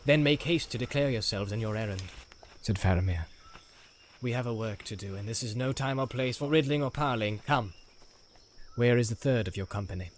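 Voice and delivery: a hint of a measured, stern leader; Faramir, stern captain. Faramir voiced in read story.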